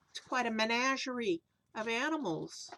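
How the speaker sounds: ordinary delivery, nothing marked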